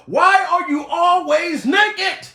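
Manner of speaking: exasperated demand. Real, not acted. Comedian scolding the absent star, exasperated shout. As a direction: draw it out in loud exasperation.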